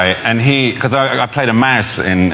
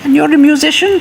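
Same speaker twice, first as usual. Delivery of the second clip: high, prim royal voice; imitating the Queen; 4 dB louder than usual. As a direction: mimic a gracious royal. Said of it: "she said".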